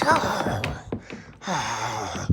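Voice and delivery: beast's cry. Acted. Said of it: "And the beast went".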